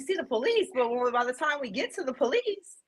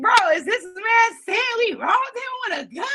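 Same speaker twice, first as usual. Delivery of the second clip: shocked disbelief; 13 semitones higher, 4 dB louder than usual. Retold or imitated. Disbelief at false gun claim.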